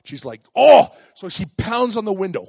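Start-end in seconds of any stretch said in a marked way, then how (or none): 0.5-0.9 loud, high exasperated "oh"; mad grandma. Retold or imitated. Grandma spots the child playing in the ditch, mad outburst. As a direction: burst out as an angry grandma. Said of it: "she's mad".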